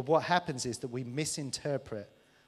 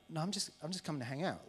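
soft casual reply; 6 dB quieter, faster than usual. Acted. God voiced softly.

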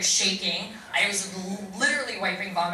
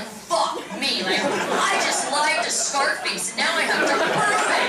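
higher, louder, building exasperation; comic panic; 8 semitones higher, 6 dB louder, slower than usual. Retold or imitated. Actor caught lying to Scarface about poker, in comic panic. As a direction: build to a loud, high-pitched mock despair.

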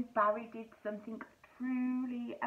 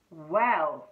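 lower, louder Barry; 6 semitones lower, 9 dB louder than usual. Acted. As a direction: start slowly like Barry. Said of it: "said Barry".

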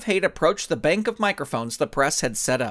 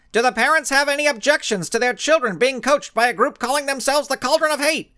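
reporter calling out; 10 semitones higher than usual. Acted. Reporter shouting a question at the Blinding Skull. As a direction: call out over the crowd.

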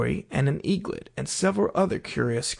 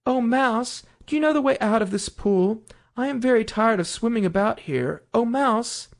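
higher voice, politely appealing; Alice addressing the mouse; 7 semitones higher than usual. A narrator's reading.

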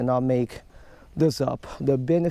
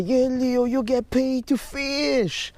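higher, teasing kid imitation; mock kid voice; 8 semitones higher, slower than usual. YouTuber imitating kids who mock his job, higher teasing mimicry. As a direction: mimic a smug kid online. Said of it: "Some kids are usually".

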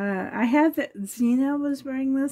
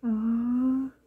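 normal talking voice

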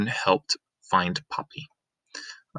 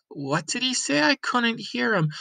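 higher, puzzled voice from the crowd; puzzled townsperson; 13 semitones higher than usual. Acted. A townsperson in the crowd asking what the mayor said, puzzled and higher. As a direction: ask as if straining to hear. Crowd member can't hear.

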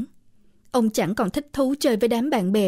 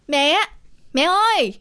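put-on boy yelling for mom, higher and louder; calling out loud; 6 semitones higher than usual. A narrator's reading. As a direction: shout across the house for mother. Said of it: "ông vừa la lớn". (gọi mẹ thật lớn)

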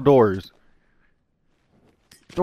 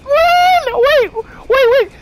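very high, loud wailing shriek; frantic high-pitched screeching; panicked screaming; 6 dB louder, much slower than usual. Real, not acted. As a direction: scream as if about to be killed. Nearly killed, fleeing the storm.

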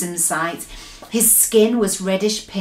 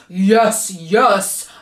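mildly booming giant voice; understated storybook giant boom; 4 dB louder than usual. A narrator's reading. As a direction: boom it back as a bullying giant. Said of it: "boomed the bu".

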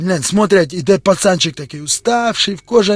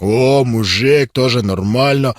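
low slow approval; 8 semitones lower, slower than usual. Acted.

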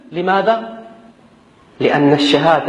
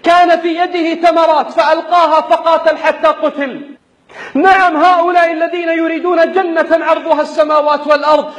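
raised, insistent sermon voice; fiery preaching; 9 semitones higher than usual. Acted. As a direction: preach it with rising fervor. Rousing sermon to fighters.

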